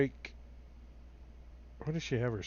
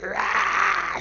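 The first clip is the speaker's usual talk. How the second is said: loud, higher open-throated roar; monster's biting roar; 5 semitones higher, 8 dB louder than usual. Acted. Game master acts out the foe lunging to bite, roaring. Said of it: "She's like".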